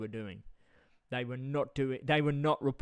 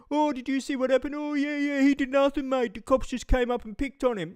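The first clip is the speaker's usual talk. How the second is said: high, fast squeaky mock mate, louder; mocking crony voice; 12 semitones higher, 6 dB louder than usual. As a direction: ham up the whiny excuse-maker. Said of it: "and go".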